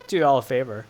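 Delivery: ordinary talk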